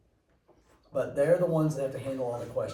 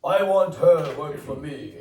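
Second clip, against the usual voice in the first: mock Eastern European; 10 dB louder, slower than usual. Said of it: "and he said".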